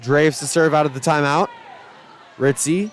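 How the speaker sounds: ordinary delivery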